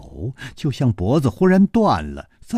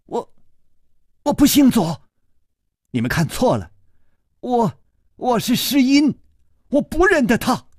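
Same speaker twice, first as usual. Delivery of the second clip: much higher, desperate outcry; frantic cry; 13 semitones higher than usual. Acted. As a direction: cry out in panic, denying. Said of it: "左姑娘忽然大叫". (左姑娘惊慌大叫，尖声否认)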